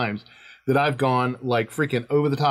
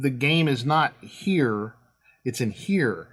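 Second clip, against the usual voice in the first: ordinary talk, nothing marked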